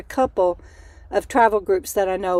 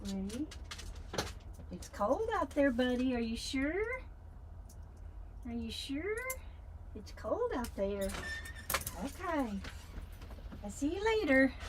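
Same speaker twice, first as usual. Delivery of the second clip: soft cooing to a dog; 2 semitones higher, 20 dB quieter than usual. Real, not acted. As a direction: hush it, sweet pet talk.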